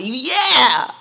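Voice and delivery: loud, high, drawn-out whoop; exultant shout; jubilant cheer. Retold or imitated. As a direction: belt it out in triumph. Celebrating the coming meeting.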